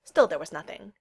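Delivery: plain reading voice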